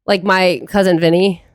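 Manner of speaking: normal talking voice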